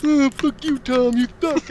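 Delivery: high, loud imitation of deaf speech; mock deaf trash-talker; deaf friend's voice. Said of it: "and he'd be like".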